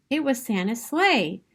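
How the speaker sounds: plain reading voice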